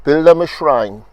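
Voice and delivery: deliberate recital, phrase by phrase with long breaths between; poetry reading. Acted. Poet reading Earthworm to the audience, measured recital. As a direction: savour each word of the list.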